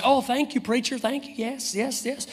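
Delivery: high, lightly voiced grateful child; a hint of a delighted child; imagined grateful girl. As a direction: play the eager little girl thanking him. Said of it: "pastor waited for the little girl to say".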